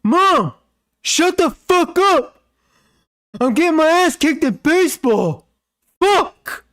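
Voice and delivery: high shrill-pitched furious yell at the dog; angry kid yelling. Retold or imitated. Gamer kid screaming at his barking dog, furious high yell. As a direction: scream it like a bratty kid losing it. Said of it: "He goes".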